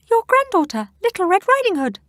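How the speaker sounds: put-on high, loud squeak faking a girl; squeaky disguise. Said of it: "squeaked the wolf".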